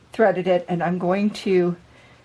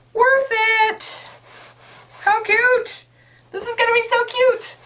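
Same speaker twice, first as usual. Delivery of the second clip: high, excited squeal of delight; thrilled, squealing with joy; 5 dB louder, slower than usual. Real, not acted. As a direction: gush over how cute it is, much higher. Delighted at the finished cover.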